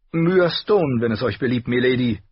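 put-on a girl bragging about the climb; boastful young girl. A narrator's reading. Myra introduces herself. (leicht großspuriges junges Mädchen)

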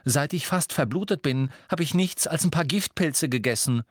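high, irritated grumbling; hungry, aggrieved outburst; growled complaint. A narrator's reading. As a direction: complain crossly about being starved. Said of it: "knurrte er Hermine an".

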